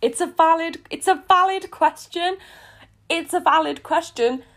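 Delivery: playful high protest; mock indignant. Real, not acted. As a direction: insist it's valid, half joking. Mock-serious defence of line.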